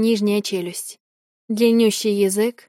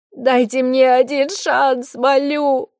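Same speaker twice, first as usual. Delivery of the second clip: desperate begging; 4 semitones higher, 3 dB louder, slower than usual. Acted. Victim begs for chance.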